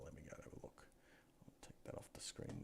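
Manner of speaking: a quick whispered aside. Real, not acted. Checking where he bought them, whispering aside. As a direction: drop to a whisper to himself.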